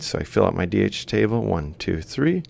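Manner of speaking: ordinary talk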